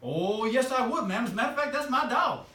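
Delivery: loud, high excited cry climbing to the end; Johnny's eager reply. Acted. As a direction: belt it out as an overjoyed Johnny. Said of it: "And Johnny says".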